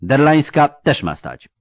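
loud, high, firm order; sharp command. A narrator's reading. Marshal holding another brigade back, sharp command. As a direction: forbid any movement. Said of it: "powiedział ostromenno".

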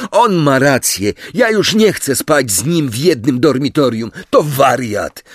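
worked-up, spiteful outburst; venomous declaration. A narrator's reading. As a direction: announce it with angry contempt. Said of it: "obrzucając Harego jadowitym spojrzeniem".

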